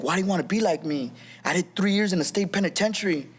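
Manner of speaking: mildly scolding father, pitch climbing; retold yelling. Upset father scolding his young son, mild mock yell. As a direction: imitate an upset dad without really shouting. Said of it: "he started yelling at me".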